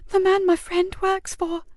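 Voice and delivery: put-on slow, high young boy's voice, hushed; a scared child confiding; frightened boy. Boy confiding in the sergeant, slow and scared. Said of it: "he whispered".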